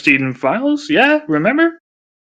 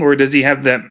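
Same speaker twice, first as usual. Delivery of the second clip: ordinary talk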